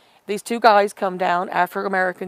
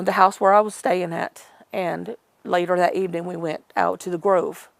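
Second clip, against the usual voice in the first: ordinary talk, nothing marked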